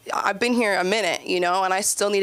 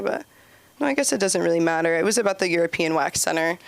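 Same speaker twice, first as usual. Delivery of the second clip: normal talking voice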